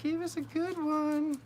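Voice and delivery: high, slow coaxing falsetto; mock pleading voice. Tarot reader coaxes the cards in a high, slow falsetto. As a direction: wheedle the cards in a squeaky voice.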